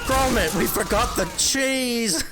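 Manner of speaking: high, slow, hammed-up British accent; bad British accent. American streamer crying out over forgotten cheese, terrible British accent. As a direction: exclaim it in cartoonish high-pitched British. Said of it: "Worst British accent I've ever heard".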